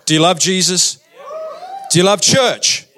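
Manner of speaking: ordinary talk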